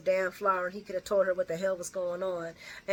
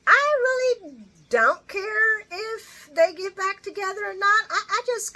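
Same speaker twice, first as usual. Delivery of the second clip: mock indifference; 13 semitones higher, 6 dB louder than usual. Real, not acted. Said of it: "mock indifference".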